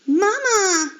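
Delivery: high, loud cry for mother. Acted. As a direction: call out for Mama in distress. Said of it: "Alfred cried".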